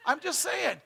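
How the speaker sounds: laughing it off, pitched high. Real, not acted. Joking to the church about a lookalike, laughing. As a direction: chuckle through the words.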